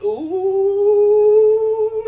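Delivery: very high, loud sung squeal; a long, sung, giddy ooh. Acted. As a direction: squeal with delight. Mallory kisses his cheek.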